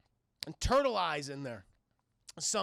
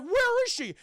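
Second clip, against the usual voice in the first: very high, loud cartoon shriek; hammed-up shouted demand; frantic demand; 8 dB louder than usual. Courage demands Muriel back.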